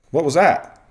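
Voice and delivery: loud, high yell across the field; coach yelling across field. Retold or imitated. Defensive coach yells across the field at the umpire, loud and high. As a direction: shout it across the diamond at the umpire. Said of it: "yells across the field and says".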